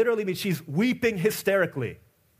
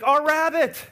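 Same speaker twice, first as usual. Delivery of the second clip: high, loud, panicked cry about the rabbit; hysterical neighbor; 8 semitones higher, 4 dB louder than usual. Retold or imitated. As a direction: shriek it like a frightened neighbor. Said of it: "And they're like".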